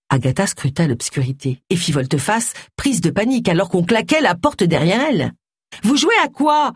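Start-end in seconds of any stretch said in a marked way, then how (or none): 5.8-6.7 high, alarmed cry; panicked shout. A narrator's reading. Agatha to Luc who slammed the door behind her, crying out. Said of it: "cria-t-elle".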